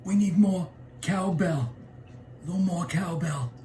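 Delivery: lightly voiced Walken cowbell line; a hint of Walken's halting delivery; Christopher Walken impression. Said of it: "You got Christopher Walken".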